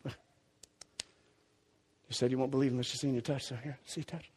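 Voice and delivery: low, soft and quick; a hushed challenge; quiet confrontation. Retold or imitated. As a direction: lay it out softly and fast. Jesus confronting Thomas.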